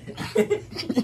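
airy high-pitched cackle; breathless high laugh. Real, not acted. Cracking up at her brother's noise, breathless laugh. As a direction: wheeze with laughter.

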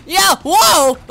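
high loud surprised shout; startled whoa. Real, not acted. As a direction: shout as the character flies off. Sudden game mishap.

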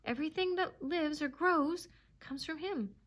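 fervent, high-pitched proclamation; exultant devotion. A narrator's reading. Dog praising his master to the animals, exultant. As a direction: rise with worshipful zeal. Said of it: "quivering with exultation".

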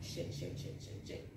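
frantic whispered curses; whispered panic. Retold or imitated. Alone in the bathroom, whispered panicked cursing. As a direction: hiss the curses under her breath.

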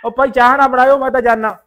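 a loud call over his shoulder as he goes. Acted. Leaving the house, he calls out loudly to the household about the tea.

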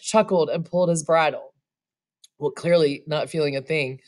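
plain reading voice

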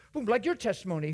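usual speaking voice

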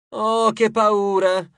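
slow, high-pitched fake fright; hammed-up taunting fear; mock shriek. A narrator's reading. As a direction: squeal in pretend terror, drawn out. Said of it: "strillò Acmone".

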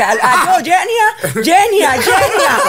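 high, loud laughing calls of the name; mock calling out. Lost student calling for the landlady, high laughing calls. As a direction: call out for help, half laughing.

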